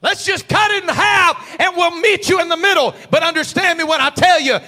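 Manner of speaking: loud, high preacher's build; preaching at full force. Acted. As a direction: drive it home to the congregation. Preaching climax.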